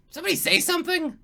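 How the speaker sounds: small goblin poking its head out of the cave, squeaky high. Acted. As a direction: peep out like a timid goblin.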